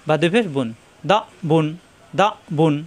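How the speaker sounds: ordinary talk, nothing marked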